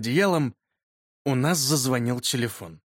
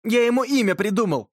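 higher, lightly voiced boyish announcement; pleased boy; 7 semitones higher, 3 dB louder than usual. A narrator's reading. Yurka tells his deskmate about the beetle, pleased boy. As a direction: announce it in a boy's raised pitch.

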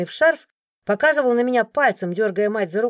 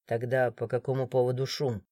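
much lower, softer stern question; cold authority, no need to shout; stern guard; 9 semitones lower, 8 dB quieter than usual. A narrator's reading. The guard questioning the crowd, low stern voice. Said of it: "Прозвучало сурово".

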